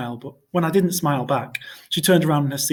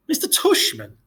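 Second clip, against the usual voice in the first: surprised. A narrator's reading. Boy hears the odd name.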